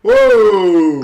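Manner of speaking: startled shout at the lost-passport story; loud whoa. Real, not acted. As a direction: blurt out a shocked whoa.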